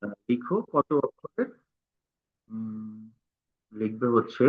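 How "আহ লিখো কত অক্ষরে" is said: normal talking voice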